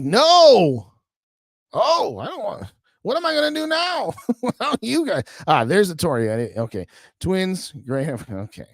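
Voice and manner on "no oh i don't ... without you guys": higher, hammed-up despair at losing chat; mock panic. Host pretending to panic when his chat vanished, mock wail. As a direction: play up the fake alarm. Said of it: "I'm like".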